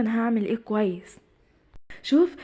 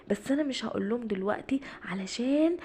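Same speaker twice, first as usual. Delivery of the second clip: a secret whisper to himself; 9 dB quieter than usual. Acted. Ardon plotting alone in the tree house, whispering. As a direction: whisper it so nobody hears. Kid keeping a secret plan.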